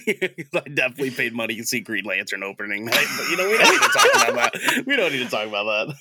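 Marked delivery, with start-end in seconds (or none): 3.3-4.8 high squeal through laughter; laughing. Real, not acted. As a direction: laugh it off, embarrassed. Embarrassed confession, laughing it off.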